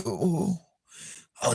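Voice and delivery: ordinary delivery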